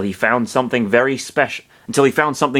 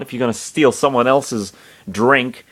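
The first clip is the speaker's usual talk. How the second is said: ordinary delivery, nothing marked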